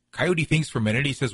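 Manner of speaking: ordinary talk, nothing marked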